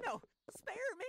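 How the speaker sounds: a man begging not to be killed; terrified plea. Acted. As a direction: beg for your life in terror.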